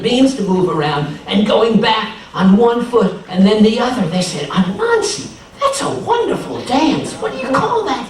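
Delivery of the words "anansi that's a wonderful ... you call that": delighted high voice. A narrator's reading. Neighbors delighted by dance.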